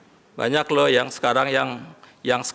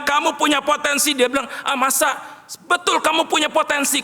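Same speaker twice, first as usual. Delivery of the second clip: climactic preaching; 12 semitones higher than usual. Acted. Preacher retelling his encouragement to the congregation, high and swelling. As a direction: swell the praise, half singing it.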